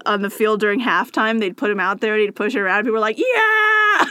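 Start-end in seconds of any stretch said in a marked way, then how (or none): 3.2-4.1 high, loud cheering yell; crowd cheer. Retold or imitated.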